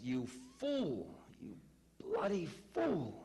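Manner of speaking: a touch of an angry guru's yell, peaking high on bloody fool; mildly voiced furious scolding, slowing on the last words; mock scream. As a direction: guru half-yelling at the devotee over the second potato. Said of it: "He comes out screaming".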